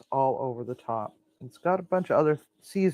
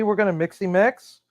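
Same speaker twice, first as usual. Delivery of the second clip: usual speaking voice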